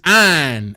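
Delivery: "ein" said as a Houston nasal grunt. Retold or imitated. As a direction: clip "ein" like a Houston local. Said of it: "I could tell a person from H-Town 'cause of their accent".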